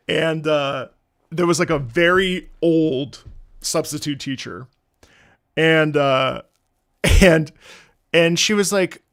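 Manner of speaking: normal talking voice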